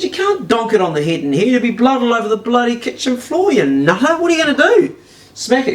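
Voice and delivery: high-pitched, incredulous retold protest; exasperated objection. He objects to Bruce's plan, exasperated. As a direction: protest in rising disbelief.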